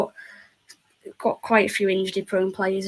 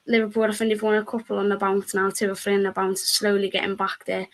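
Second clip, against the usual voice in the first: usual speaking voice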